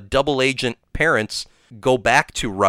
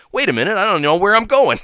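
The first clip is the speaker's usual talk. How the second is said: raised, louder lost tourist voice; lost Peter; 8 semitones higher, 5 dB louder, faster than usual. Retold or imitated. Peter lost on a Moscow street, lightly mock confused. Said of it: "he's like".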